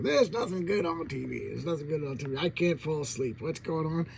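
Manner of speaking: lightly voiced grumbler; a touch of put-on griping; mock complaining. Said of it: "he's a complainer".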